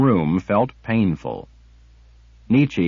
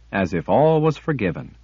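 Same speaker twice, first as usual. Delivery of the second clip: ordinary delivery, nothing marked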